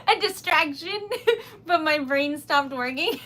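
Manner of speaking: high, loud, laughing through the words; giggly, high-pitched and helpless; flustered laughter. Real, not acted. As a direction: crack up at your own blank brain. Flustered, laughing at losing her words.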